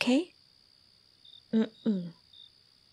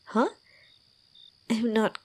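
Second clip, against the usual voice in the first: normal talking voice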